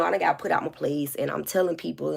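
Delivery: normal talking voice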